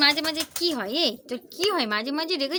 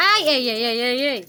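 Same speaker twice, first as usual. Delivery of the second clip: louder repeated warning calls; loud scolding; 8 dB louder than usual. Real, not acted. Owner scolding the pet bird, loud repeated warning. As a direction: bark warnings to stop it.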